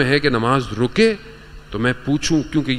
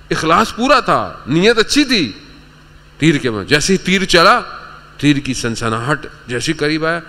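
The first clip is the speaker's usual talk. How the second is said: raised, animated sermon storytelling; impassioned preaching; 6 semitones higher, 3 dB louder than usual. Real, not acted. Preacher telling a reciter's dream of Karbala, impassioned. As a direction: build the tale with fervour, pitch lifted.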